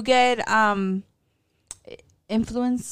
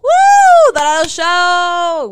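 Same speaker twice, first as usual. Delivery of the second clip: loud, stretched-out hype shout; excited cheer; 7 semitones higher, 17 dB louder, much slower than usual. Real, not acted. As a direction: hype up the band like a crowd. Cheers the band name.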